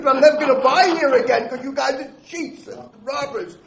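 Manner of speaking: half-laughing mock yell at the shopkeeper; loud, laughing retold outburst at a cheating store; retold screaming. Angry customer yells at the shop clerk, half laughing. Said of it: "I just started screaming".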